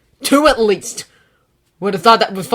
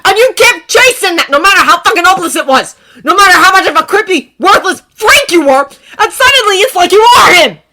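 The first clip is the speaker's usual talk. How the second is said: louder, much higher furious rant; raging tirade; 13 semitones higher, 16 dB louder than usual. Acted. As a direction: rage through it.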